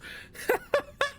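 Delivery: sudden high-pitched burst of laughter; high squealing laugh. Real, not acted. Cracks up at own joke.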